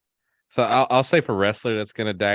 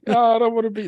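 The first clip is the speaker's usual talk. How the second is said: much higher, teasing imitation of a friend; mock whiny friend; 14 semitones higher, 5 dB louder, faster than usual. Host mocking a co-host's line from last week, high teasing imitation. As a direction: squeak it out like a mocked buddy. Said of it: "He's like".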